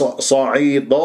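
usual speaking voice

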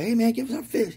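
high-pitched retold call; imitated demand. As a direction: imitate a friend calling out in protest. Acts out friend repeating the demand.

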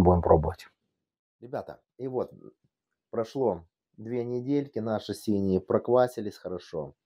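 ordinary talk, nothing marked

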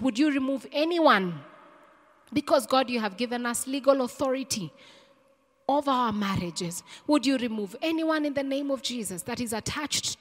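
impassioned public prayer, pressing each request; fervent prayer. Real, not acted. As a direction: call on God with rising force. Leads a forceful prayer.

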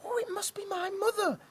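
high squeaky hopeful chick; cheeping chick. Acted. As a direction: peep it like a chick. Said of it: "cheeped little chick".

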